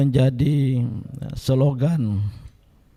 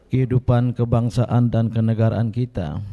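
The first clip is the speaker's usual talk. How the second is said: ordinary talk, nothing marked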